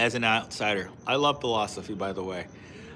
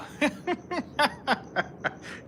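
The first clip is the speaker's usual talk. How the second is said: long, high peal of laughter; real laugh; 12 semitones higher, 5 dB quieter than usual. Real, not acted. Streamer reads grandfather darker than you, high laughing fit. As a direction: burst into high giggles.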